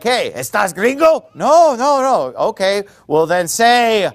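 hammed-up border guard test; mock border guard. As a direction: play the suspicious border guard, half joking. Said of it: "it's like".

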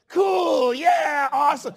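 high, loud, delighted cheer; imitating thrilled kids. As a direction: cheer like a kid who just saw the bug squashed. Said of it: "my kids will be like".

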